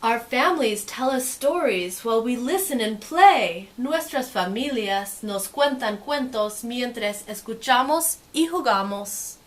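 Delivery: lively, sing-song storybook read; reading to children. Acted. Teacher reading a picture book to kids, lively and sing-song. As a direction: make the page sparkle for little listeners.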